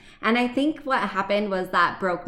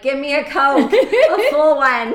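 high, loud, laughing demand; loud, laughing demand for soda; desperate demand; 6 semitones higher, 9 dB louder, slower than usual. Retold or imitated. Desperate mom demanding caffeine.

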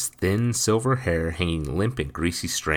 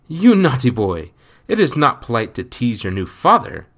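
playful scolding, higher and louder; laughing scold; 6 semitones higher, 8 dB louder than usual. A narrator's reading. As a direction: tease him with a laugh. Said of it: "With a laugh".

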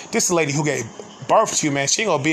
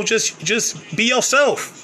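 slow, higher, drawn-out counsel; emphatic advice; 6 semitones higher, slower than usual. Retold or imitated. Quoting shelter friends coaching him, slow and emphatic.

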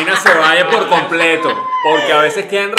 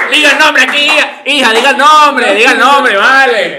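higher, louder teasing call; mock hostess call; 9 semitones higher, 7 dB louder than usual. Host loudly urges a guest to say her name. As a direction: boom it out like a show host.

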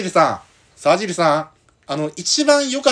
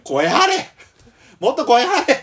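higher, louder yelled order; shouting; 12 semitones higher, 6 dB louder, slower than usual. Real, not acted. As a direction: bark it across the room. Yelling at partner to project.

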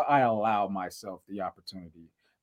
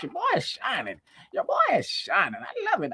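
high gloating; 11 semitones higher than usual. Acted.

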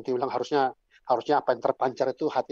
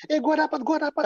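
put-on much higher, louder teen cry; imitated excited yell; 12 semitones higher, 4 dB louder than usual. Teen girl announces she got a counselor, high excited mock yell. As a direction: act out a thrilled girl yelling. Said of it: "Terus dia teriak".

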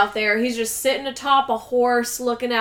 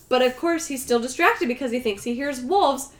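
normal talking voice

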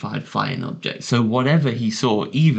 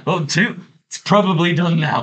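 higher, more anxious as the car keeps flipping; rising mock panic; 7 semitones higher, 3 dB louder than usual. Imagined driver counting car flips, getting higher and worried. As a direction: count the flips with growing alarm. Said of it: "I'd probably be like".

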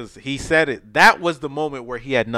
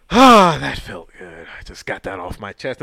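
imitated relief; 4 semitones lower than usual. Killer sits down relieved after killing.

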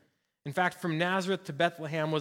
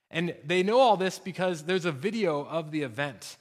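ordinary talk

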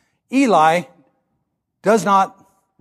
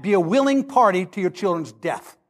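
normal talking voice

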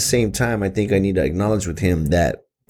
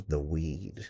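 very soft, lower voice; imitated hushed reveal; 5 semitones lower, 18 dB quieter than usual. Son revealing slang to his dad, hushed imitation. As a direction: drop to a near-whisper, imitating the kid. Retells son's knowing word for marijuana.

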